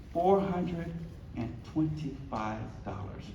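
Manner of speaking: "Four hundred and twenty-five dollars" dropping low and soft; hushed low "twenty-five dollars"; quiet. Shown as a demonstration. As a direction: let the amount fall away softly. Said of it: "They cannot raise".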